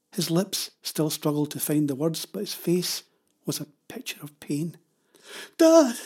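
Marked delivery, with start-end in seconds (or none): 5.6-6.0 high, loud cry of alarm; alarmed gasp. A narrator's reading. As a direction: call to Dad in shock. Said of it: "Jenny gasped".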